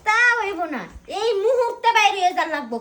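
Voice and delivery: high, loud order; angry refusal. Acted. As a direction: send him out this very minute. Wife orders husband out.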